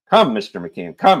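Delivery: a breezy magistrate teasing the prisoner; jovial magistrate. A narrator's reading. Magistrate hails the prisoner, loud and jovial. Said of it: "he said, leaning back in his chair".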